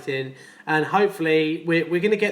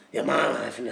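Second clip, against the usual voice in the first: much lower, menacing put-on voice; Papa Lazarus; 7 semitones lower, 4 dB quieter than usual. Plumber showing off his Papa Lazarus impression, low and menacing. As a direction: growl it out like the sinister ringmaster. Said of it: "I can do Papa Lazarus as well".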